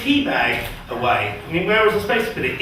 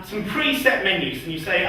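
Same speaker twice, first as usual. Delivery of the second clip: ordinary talk, nothing marked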